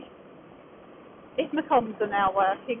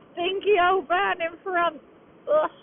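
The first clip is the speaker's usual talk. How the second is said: disgusted; 7 semitones higher, slower than usual. Real, not acted. Smelly van ahead.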